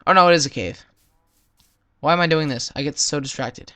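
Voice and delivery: normal talking voice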